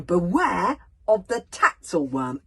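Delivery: a bodyguard spelling out a warning sign, then exasperated; Bodkin reading aloud. Acted. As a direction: read the sign out with mounting alarm. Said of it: "he reads the signal out for them".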